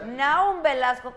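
high, loud, stretched-out name call; rousing introduction. Real, not acted. Host welcoming the chef, rousing introduction. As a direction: call the guest out to the crowd.

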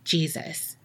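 very soft, low single word; weak, hushed retold utterance; forced out. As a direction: barely get the word out. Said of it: "she finally forces out".

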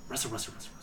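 very soft, quick rustle sound; game master's soft sound effect; hushed rustling. Acted. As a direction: whisper it like leaves stirring.

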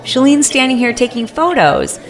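high, mock-cheerful bystander voice; cheerfully cash in on the moment; mock delighted onlooker. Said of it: "the line of people are like".

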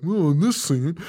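mock smug actor; Robert Englund. Host imitating an actor in the documentary, smug. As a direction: put on a lightly smug, self-satisfied voice. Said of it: "act like a smug asshole".

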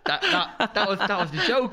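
high, laughing protest; real helpless amusement. Real, not acted. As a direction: protest through your laughter. Laughing over the crude joke.

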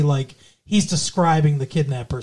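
normal talking voice

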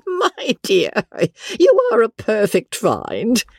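put-on high, delighted old lady's voice. Old lady praising Becky, high and delighted. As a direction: coo over her new favourite.

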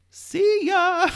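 high, loud sing-song "see ya"; mocking goodbye. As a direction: toss off a snooty farewell. Mocking those climbing away.

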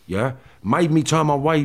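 usual speaking voice

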